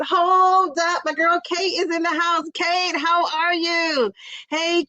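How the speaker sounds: high, slow, sung-out hello; excited greeting. Real, not acted. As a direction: stretch it out, thrilled she came.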